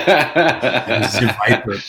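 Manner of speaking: very slow, mock-ominous announcement; menacing villain intro. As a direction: drag out each word like a cheesy villain. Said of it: "It's like".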